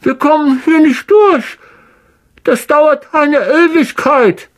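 high, helpless question; desperate. A narrator's reading. Ron stuck behind the rocks: desperate question. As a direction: ask like you see no way out. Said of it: "verzweifelt klingende Stimme".

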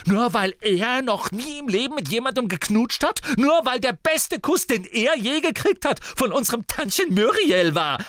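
very high, loud tirade; furious taunting. A narrator's reading. Sister mocks her brother's lack of kissing, furious. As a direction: shout the taunts at full force.

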